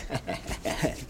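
quiet, breathy snicker; gloating snicker. Acted. Lawyer thinking he has won the hundred dollars, gloating snicker. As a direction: snicker under the breath in secret victory.

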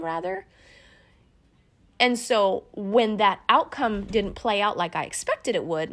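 usual speaking voice

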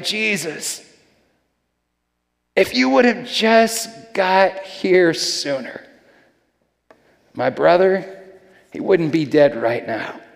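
mild, slowed frustration, retold; a touch of hurt reproach, held back; frustrated, hurt. Grieving sister to Jesus who came too late, frustrated. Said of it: "she's frustrated at Jesus".

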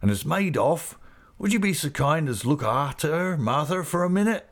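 high, happy rustic voice; Mister Peggotty, delighted. A narrator's reading. Uncle asking someone to look after his niece, high and delighted. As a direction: let delight break out in the request. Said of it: "Here his delight broke out again".

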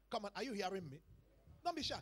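ordinary talk, nothing marked

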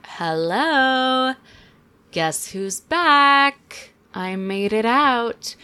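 a lightly put-on voice for the husband back from the dead; imitating dead husband.

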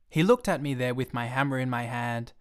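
ordinary delivery

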